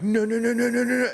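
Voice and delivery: high, rapid run of mock-panicked syllables; panicky. Imitating a four-year-old backing from a penguin, panicky. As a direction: rattle it out high like scrambling little feet. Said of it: "her feet are like".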